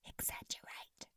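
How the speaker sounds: barely audible breathy whisper. A narrator's reading. Lover whispering a secret clue into her ear, breathy whisper. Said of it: "whispered a word so quiet and breathy".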